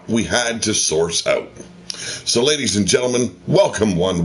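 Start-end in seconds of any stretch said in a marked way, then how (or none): none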